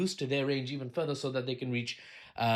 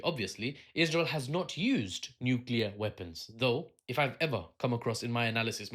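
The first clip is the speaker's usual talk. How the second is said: ordinary talk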